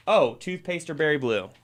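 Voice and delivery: ordinary talk, nothing marked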